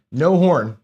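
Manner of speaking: ordinary talk, nothing marked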